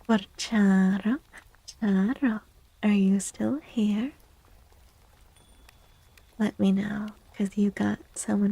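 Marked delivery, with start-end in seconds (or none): none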